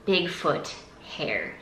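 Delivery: usual speaking voice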